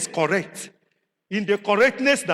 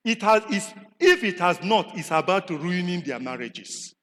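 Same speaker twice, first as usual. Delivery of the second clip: ordinary talk, nothing marked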